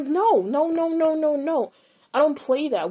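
high-pitched, insistent string of refusals; retold firm refusal. Retold emphatic refusal to be friends.

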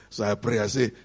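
ordinary talk

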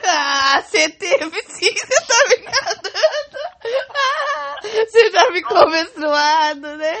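loud, high teasing laugh-talk; laughing loudly. Real, not acted. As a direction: tease through loud laughter. Teasing partner, laughing hard.

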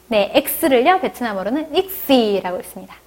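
ordinary delivery

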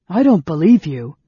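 loud denial. A narrator's reading. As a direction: throw the words back in disbelief. Son refuses the truth.

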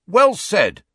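high, loud bark of approval. A narrator's reading. Student backing the speaker before the group, barked. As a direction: bark it out emphatically. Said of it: "barked Ernie Macmillan".

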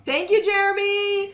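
loud high call across room. Real, not acted. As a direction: shout thanks to passerby. Calling out to passerby.

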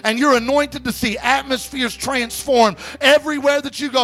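high, driving, fervent preacher voice; impassioned exhortation, pitched high with punched phrases; full-force preaching. Real, not acted. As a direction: build it to a ringing pulpit climax.

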